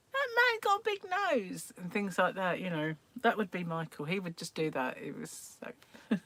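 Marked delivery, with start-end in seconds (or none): 0.1-1.7 put-on high-pitched little kid voice; small child imitation. Child remarking on a stranger, high piping voice. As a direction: pipe up high like a tactless child.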